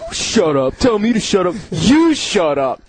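put-on slow, dragged-out drunken retort; mock belligerent drunk voice; imitated drunk comeback. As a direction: play a drunk squaring up, ending slow and forceful. Imitating drunk G's delayed comeback.

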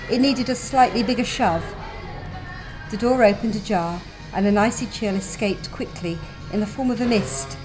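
usual speaking voice

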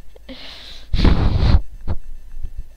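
breathy, voiceless laughing; wheezy laughter. Real, not acted. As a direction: laugh helplessly at your own blunder. Laughs at running off the edge.

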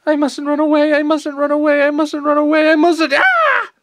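put-on loud, high boy chanting in fear, breaking into a cry; terrified boy repeating his mantra; panicked mantra. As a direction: psych yourself up, then crack.

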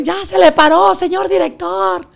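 shrill squeal to the director; shrill wife voice. Retold or imitated. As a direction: imitate her squeaky excited voice. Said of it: "como tiene la voz chillona".

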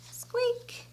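high, soft little squeak; mouse squeak. A narrator's reading. Storyteller voices the mouse for children, high soft squeak. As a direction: squeak lightly like a tiny mouse.

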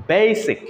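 Basic as "baay-sic", first vowel long; lengthened A. Shown as a demonstration. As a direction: stretch the "ay" in বেসিক. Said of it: "লম্বা করে নিতে হচ্ছে". (বেসিক-এর এ টেনে লম্বা করে)

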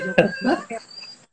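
big high burst of laughter; loud laugh. Real, not acted. Laughing at crying in the helicopter. Laughing at her own misfortune.

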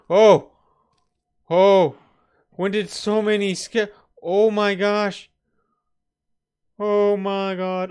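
high, loud alarmed shouts, drawn out; panicked cries. Real, not acted. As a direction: yelp in real fright at the mobs. Surrounded by mobs at night.

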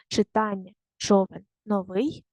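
normal talking voice

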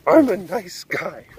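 slow smug nice guy; drawn-out self-pitying nice guy; nasal smug neckbeard. Pretend. Neckbeard punchline.

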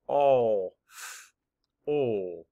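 All, f, all each said as a separate sound; segmented sounds of awful; sounds mapped apart. Shown as a demonstration. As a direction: tap out All, f, all one sound at a time. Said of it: "Map the sounds".

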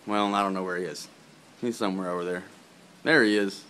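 ordinary talk, nothing marked

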